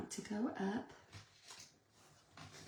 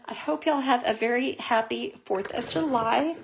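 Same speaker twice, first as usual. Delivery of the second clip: much louder, beaming goodbye to the viewers; loud cheerful sign-off; 3 semitones higher, 11 dB louder than usual. Real, not acted. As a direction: send them off with a big happy farewell.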